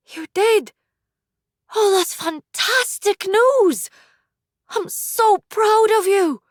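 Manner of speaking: high, slow, beaming with pride; overjoyed mother. Acted. Mother thrilled at her son's news, high and overjoyed. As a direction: gush with pride.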